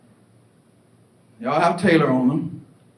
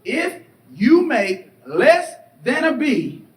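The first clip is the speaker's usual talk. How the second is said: stern mother; 5 semitones higher, 6 dB louder, slower than usual. Retold or imitated. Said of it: "let me define winning".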